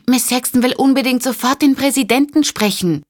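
a flustered operator calling to get through the crowd. Acted. The operator restates the demand.

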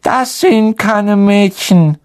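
"Das ist ein Mädchenklo" drawn out slowly, high and whiny; suspicious ghost girl. Acted. Myrte to the boys, slow, high and suspicious. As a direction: eye the boys with distrust.

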